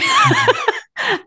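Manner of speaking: emotional squeaky laugh; high moved laugh. Real, not acted. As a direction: laugh through rising tears.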